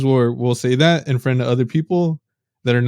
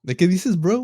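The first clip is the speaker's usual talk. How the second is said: higher, teasing imitation of dad; funny mocking dad; 6 semitones higher than usual. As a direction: tease like a dad joking in Spanish. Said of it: "the way he says it sounds funny".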